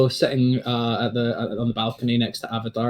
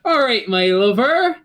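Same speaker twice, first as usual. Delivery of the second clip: a proud father beaming over his son; jolly old dad; 12 semitones higher than usual. Acted. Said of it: "ruffles his hair and goes".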